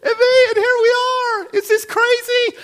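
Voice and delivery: very high, loud and tearful with joy; Jesus overjoyed at finding the lost sheep. Acted. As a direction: sing out the rescue, near tears with delight. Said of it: "and says".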